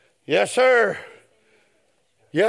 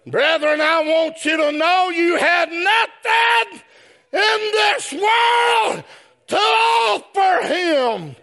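full-force preacher cry; preaching at full force; 9 semitones higher than usual. Real, not acted. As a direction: call it out to the congregation, slow and ringing. Preacher builds to shout.

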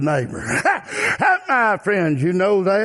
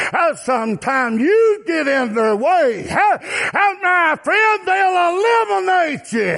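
preacher's shouted warning; 9 semitones higher than usual. Real, not acted. Preacher warning that people will eliminate you, shouted warning. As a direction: lift it into a sung-out shout.

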